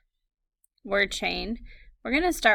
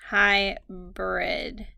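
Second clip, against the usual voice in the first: "Hybrid" stretched slowly into two syllables. Shown as a demonstration. Teacher sounding out the word for spellers, "Hybrid" slow and segmented. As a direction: draw out both syllables of "Hybrid".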